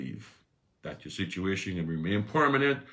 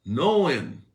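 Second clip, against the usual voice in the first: loud emphatic knowing; 8 semitones higher, 10 dB louder than usual. Acted. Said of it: "repeated emphatic".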